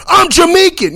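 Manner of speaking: very high, loud mock outburst; flipping out. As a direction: correct them like someone acting outraged. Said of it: "They're gonna flip out".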